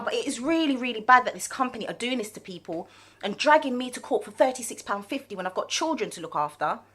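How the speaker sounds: normal talking voice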